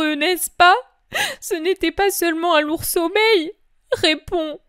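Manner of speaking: a mother refusing to believe her son is gone; heightened voice of the grieving mother; grieving Mary. Acted. Mary questions John.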